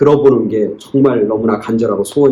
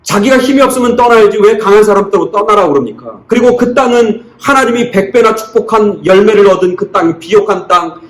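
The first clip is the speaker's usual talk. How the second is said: loud, driving sermon climax, voice raised high; impassioned preaching; 8 semitones higher, 5 dB louder than usual. Acted. Preacher stirring the congregation over Isaac's injustice, fervent raised preaching. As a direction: preach it hard, pressing each question on the flock.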